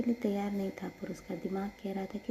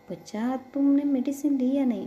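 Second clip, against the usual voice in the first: higher, louder fond scolding; caring scold; 6 semitones higher, 7 dB louder than usual. Acted. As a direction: nag her lovingly about medicine. Sister scolding fondly about medicine.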